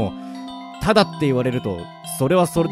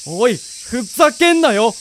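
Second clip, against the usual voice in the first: raised, exasperated yell; angry outburst; 7 semitones higher, 6 dB louder, much slower than usual. Acted. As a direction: explode at the machine that ate your coins. Vending machine swallowed his money. (怒って叫ぶ)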